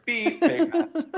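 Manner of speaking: high beep over the curse word; censor bleep. Shown as a demonstration. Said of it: "you just hear".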